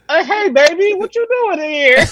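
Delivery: put-on high, suspicious old-lady voice; grandma probing grandson, high voice. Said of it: "she on some".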